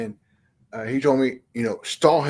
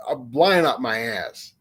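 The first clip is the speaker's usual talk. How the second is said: ordinary talk, nothing marked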